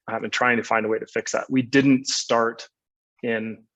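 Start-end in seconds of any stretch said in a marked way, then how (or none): none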